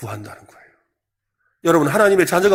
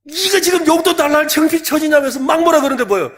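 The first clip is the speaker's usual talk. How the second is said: scolding parent; 8 semitones higher, 5 dB louder than usual. Retold or imitated. Parent scolds child for allowance.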